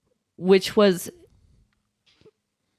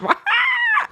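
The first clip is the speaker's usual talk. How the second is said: high laugh. Real, not acted. Laughing at own story.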